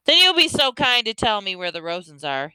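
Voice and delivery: moderately forceful soldier's order; unlowered soldier's voice. A narrator's reading. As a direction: demand it without lowering your voice. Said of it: "He made no effort to lower his voice".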